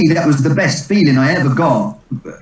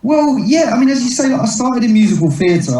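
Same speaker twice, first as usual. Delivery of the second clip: high put-on voice; 12 semitones higher than usual. Comedian answering the host in a squeaky put-on voice. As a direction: answer in a sudden high, jokey voice.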